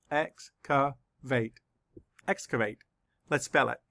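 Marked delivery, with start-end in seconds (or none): 0.1-1.6 slow, split into syllables, EX-ca-vate. Shown as a demonstration. Teacher counting syllables for the class, slow and split. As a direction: tap out each syllable of Excavate slowly. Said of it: "Let's count the syllables".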